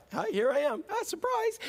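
high singsong pop-up greeting; playful surprise. Acted. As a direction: announce yourself like a jokey surprise. Jokes as Jesus popping up.

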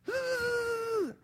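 wheels screaming in mud. Retold or imitated. Car wheels spinning in mud.

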